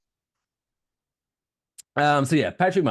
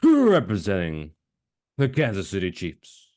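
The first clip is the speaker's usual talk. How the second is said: stretched-out fighter introduction; ring announcer; 7 semitones higher, 6 dB louder, slower than usual. Acted. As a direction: hold the name long, first name high and loud.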